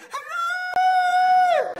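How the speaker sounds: high, loud hooray screech; mock fox scream. Imitating the fox heard down the phone, shrill yell.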